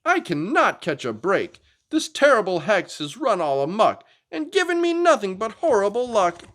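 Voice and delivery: high, whiny complaint; a dinosaur girl sulking at the table; complaining Anda. Acted. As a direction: grumble about the hex. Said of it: "Anda complained".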